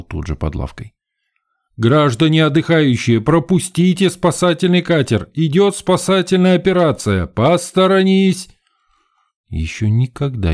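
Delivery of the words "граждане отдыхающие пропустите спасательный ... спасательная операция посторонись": high, projected public announcement; urgent rescue-boat crowd warning; yelling through a megaphone. A narrator's reading. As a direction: holler orders at the bathers. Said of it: "распугивая купающихся воплями в рупор".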